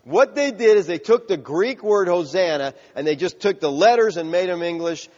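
ordinary talk

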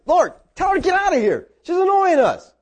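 high-pitched imitation of annoyed disciples; mock whining protest; exasperated complaint. Disciples complain about the crying woman.

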